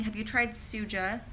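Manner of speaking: usual speaking voice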